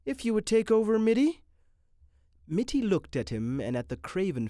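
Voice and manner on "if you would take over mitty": high, louder imploring plea; pleading Renshaw. A narrator's reading. Renshaw begging Mitty to take over, pleading. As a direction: beg him to take over.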